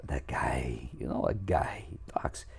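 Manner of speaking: low, whispery mumble of a man's voice; impression of a guy. Vocal coach imitating a guy who talks interestingly, low whispery impression.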